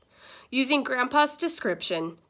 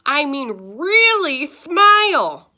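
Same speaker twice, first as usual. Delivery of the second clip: loud, higher, slow insistent command; angry demand; 6 semitones higher, 11 dB louder, slower than usual. Acted. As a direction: demand a real smile impatiently. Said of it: "shouted Grandpa angrily".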